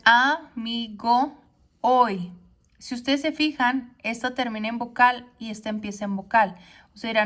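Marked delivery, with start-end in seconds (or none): 0.0-2.3 "amigo, hoy" very slow, the final o of "amigo" running into "hoy"; sinalefa demo. Shown as a demonstration. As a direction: stretch "amigo" slowly and link its last vowel into "hoy". ("amigo, hoy" muy despacio, uniendo las vocales)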